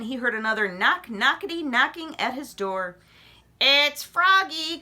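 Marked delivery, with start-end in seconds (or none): 3.6-4.8 croaky frog. A narrator's reading. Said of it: "cried the same deep voice".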